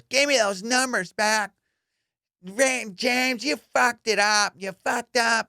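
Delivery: hammed-up angry boss chewing him out; mock yelling lady. Lady yelling at the security guard, high mock scolding. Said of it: "she's yelling at the security dude".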